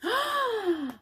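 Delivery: sudden high intake of breath; a surprised storyteller gasp; mock-startled gasp; sharp high gasp. A narrator's reading. Storyteller gasps at discovery.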